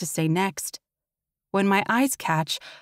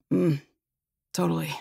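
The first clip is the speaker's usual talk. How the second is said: grumbling; 2 semitones lower than usual. Acted. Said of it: "he grumbles".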